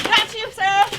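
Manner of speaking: loud high call across the field. Real, not acted. Calling a hit in game.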